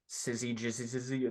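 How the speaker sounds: syzygy misread softly as sizzy, jizzy, zizzy; fumbled mispronunciation. Retold or imitated. Recalling a younger self misreading the word, fumbled mispronunciation. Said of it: "I thought it was, like".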